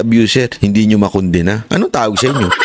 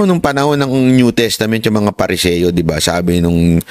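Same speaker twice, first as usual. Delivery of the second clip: ordinary talk, nothing marked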